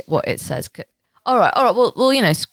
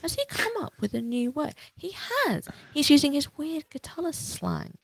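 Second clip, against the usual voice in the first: high, hushed incredulous murmur; small, high, appalled voice; dismayed realisation; 10 semitones higher, 10 dB quieter than usual. Acted. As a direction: realise it quietly, pitched right up. Acted friend reading the poem.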